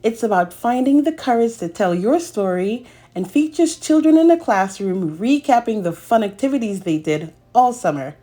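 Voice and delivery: plain reading voice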